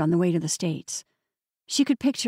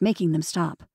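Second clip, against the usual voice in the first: ordinary delivery, nothing marked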